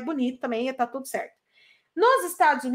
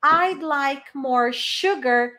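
"I'd like more sugar" said slowly, word by word; teacher spelling out "I'd like more sugar" for the class; slow model sentence; much slower than usual. Shown as a demonstration. Teacher models the order for her students, slowly. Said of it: "Aí você pode".

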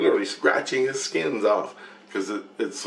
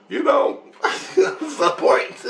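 laughing; 13 semitones higher, much slower than usual. Retold or imitated. Gloating reply in retold scene.

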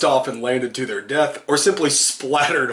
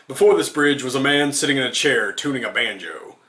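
usual speaking voice

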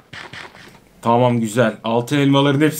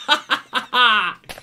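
higher-pitched bout of laughter; 5 semitones higher than usual. Real, not acted. Gamer laughing after the apples run out, laugh. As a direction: laugh helplessly at the defeat.

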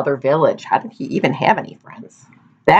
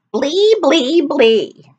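high squeaky "Blee, blee, blee" falling at the end; silly blee; 10 semitones higher, much slower than usual. A narrator's reading. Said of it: "when he said".

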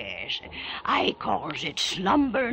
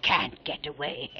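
plain reading voice

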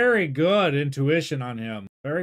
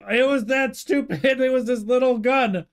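high, louder startled reaction; shocked; 9 semitones higher, 5 dB louder than usual. Real, not acted. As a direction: blurt it out in disbelief. Shocked reaction to accidental shot.

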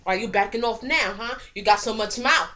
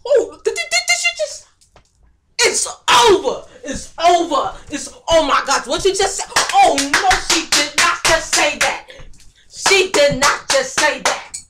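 loud, higher, stammering into drawn-out indignation; outraged disbelief; 8 semitones higher, 10 dB louder than usual. Acted. Bully cannot believe April insulted her, loud and outraged. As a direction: react as if mortally insulted, loudly.

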